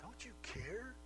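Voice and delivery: a sister's put-upon, needling complaint; condescending. Acted. Martha complaining to Jesus, condescending and reproachful. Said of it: "condescending tone in her voice".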